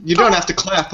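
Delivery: normal talking voice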